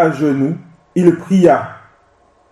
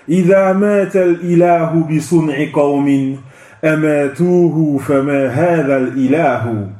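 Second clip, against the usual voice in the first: measured Arabic verse, a touch quicker; poem recitation; 3 semitones lower, faster than usual. Acted. Recites Ibn al-Qayyim's Arabic poem. (récitation du poème en arabe)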